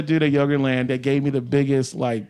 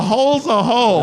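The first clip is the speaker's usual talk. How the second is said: hammed-up brash hollering; 6 semitones higher than usual. Repeats the promoter's punchline.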